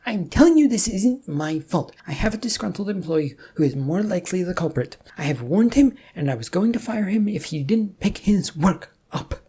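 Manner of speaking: high, loud protesting boss. A narrator's reading. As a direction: boss loudly shifting the blame. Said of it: "the voice of his boss could be loudly heard".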